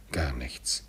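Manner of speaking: soft, low, half-whispered; subdued reply. A narrator's reading. Scrooge answers the ghost's question, quietly dismissive. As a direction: brush it off under your breath.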